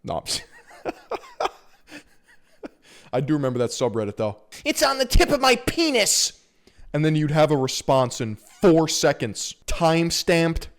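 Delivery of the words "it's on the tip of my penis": squeaky mocking poster voice; mock a desperate poster; mocking high voice.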